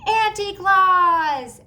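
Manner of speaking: high, loud and very slow call; girl greeting her aunt with a big shout; joyful shout. A narrator's reading. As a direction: call it out in delight. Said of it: "Sophie shouted".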